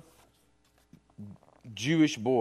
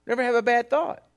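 ordinary delivery

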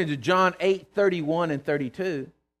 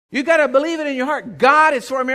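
louder and higher, ringing declaration; rousing preaching shout; 8 semitones higher, 10 dB louder than usual. Acted. Preacher urging congregation to believe, loud ringing call. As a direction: call it out to the room.

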